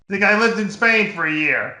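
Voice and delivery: usual speaking voice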